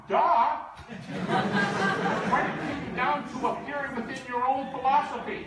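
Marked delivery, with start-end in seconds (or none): 0.1-3.6 mock duh. Real, not acted. Mocking the obvious objection.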